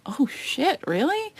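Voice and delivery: disbelieving delight; stunned. Retold or imitated. Hearing her mom will be on the show, stunned. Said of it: "And I'm like".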